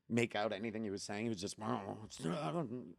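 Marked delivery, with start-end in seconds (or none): 1.6-3.0 soft, indistinct mumble; imitated mumbling. Retelling the stranger at the door, imitated mumbling. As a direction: let the words blur together under your breath. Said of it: "he just, he just starts mumbling".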